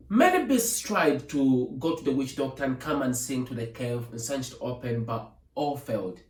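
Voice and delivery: plain reading voice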